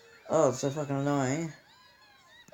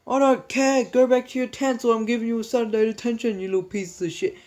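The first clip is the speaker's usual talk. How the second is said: much higher, louder furious teacher yell; angry teacher; 9 semitones higher, 5 dB louder than usual. Retold or imitated. Teacher threatening the kids with Saturday detention, furious yell. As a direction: rant like a teacher out of patience. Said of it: "And he's like".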